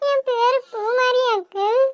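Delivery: put-on high child voice, sniffling; tearful small girl, slow; frightened little girl. Rescued girl answers, tearful.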